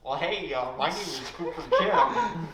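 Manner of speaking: hammed-up goofy self-introduction; Cooper Kim impression. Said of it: "I'd like to do my impression of Cooper Kim".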